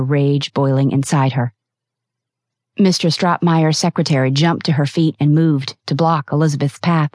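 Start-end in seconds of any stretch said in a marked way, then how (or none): none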